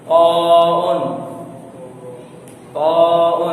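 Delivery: "ताउन" loud, chanted, with a heavy T and rounded vowel; thick T. Shown as a demonstration. Teacher showing this one is thick too, loud heavy "ताउन". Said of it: "ये भी मोटा पड़ जाएगा।".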